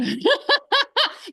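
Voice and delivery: short high self-conscious laugh; embarrassed laugh. Real, not acted. Admitting it sounds weird, sheepish laugh.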